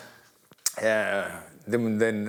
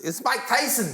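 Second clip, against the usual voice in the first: high-pitched champ announcing himself; Mike Tyson; 12 semitones higher than usual. Retold or imitated. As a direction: imitate the boxer on the phone, pitched way up. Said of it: "he rings my mate's phone".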